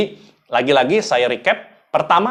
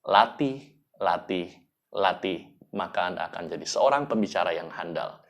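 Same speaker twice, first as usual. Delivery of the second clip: much softer and lower, slowing as it fades; fading soft close; 6 semitones lower, 8 dB quieter than usual. Shown as a demonstration. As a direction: let it sink and fade like a gentle farewell. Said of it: "ingin mengajak orang untuk menangis".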